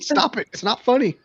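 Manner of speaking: exasperated. Retold or imitated. Retelling scolding a customer.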